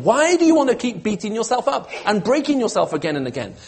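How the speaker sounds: high, urgent plea pressing on each phrase; impassioned pleading. Acted. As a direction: plead as God with a hurting people. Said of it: "God is pleading with his people here".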